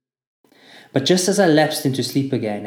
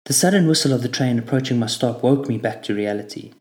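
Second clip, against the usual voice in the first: ordinary delivery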